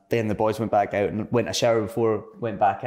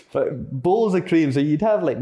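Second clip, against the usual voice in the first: ordinary delivery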